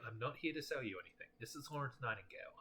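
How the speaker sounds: usual speaking voice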